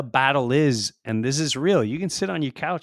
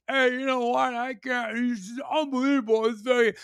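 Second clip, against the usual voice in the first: high, whiny mock of someone yelling at a tree; mock protester yell; 11 semitones higher than usual. Mocking protesters yelling at a tree, high and whiny. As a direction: play the pointless ranter, pitched high. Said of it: "it's like yelling at a tree".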